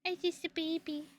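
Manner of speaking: put-on high, soft babyish voice. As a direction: play the helpless little baby. Baby voice self-pity.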